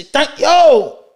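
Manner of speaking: high, loud mock phone prompt; phone recording imitation. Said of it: "It say".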